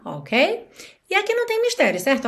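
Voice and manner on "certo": ordinary talk, nothing marked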